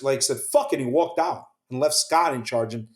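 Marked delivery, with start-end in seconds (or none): 0.4-0.8 sharp, much higher, louder mock outburst; angry outburst. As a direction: toss it off in retold anger. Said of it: "Oliver Stone got so angry".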